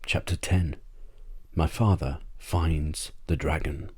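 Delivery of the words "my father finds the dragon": ordinary delivery, nothing marked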